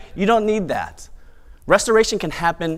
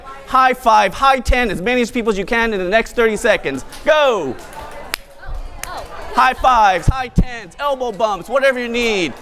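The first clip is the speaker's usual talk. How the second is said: bright event host; 9 semitones higher than usual. Acted. Rallies the crowd to mingle.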